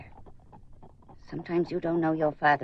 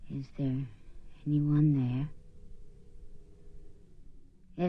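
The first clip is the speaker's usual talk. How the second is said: séance medium; 6 semitones lower, 4 dB quieter than usual. Acted. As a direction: summon the spirit gravely. Leads a séance.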